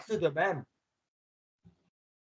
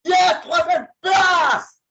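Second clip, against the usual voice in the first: high, loud, slow victory cry; triumphant shout; 12 semitones higher, 10 dB louder, much slower than usual. Real, not acted. Gamer celebrating third place in race, triumphant shout. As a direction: yell it out as you cross the line. Cheering his race placing.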